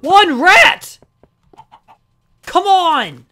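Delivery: fed-up outburst; exasperated shout. Real, not acted. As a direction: shout in frustration. Exasperated search for rats.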